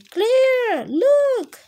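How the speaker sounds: very high, loud call. A narrator's reading. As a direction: call her name from far above. Family calls from the sky.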